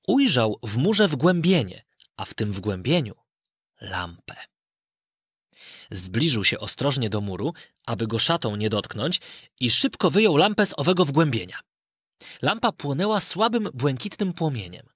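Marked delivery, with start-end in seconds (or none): none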